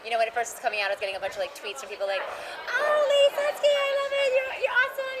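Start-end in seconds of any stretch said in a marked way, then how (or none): 2.2-5.1 high, giggly fan gushing, much higher. Retold or imitated. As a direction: squeal it like an adoring fan. Retelling gushing fan tweets.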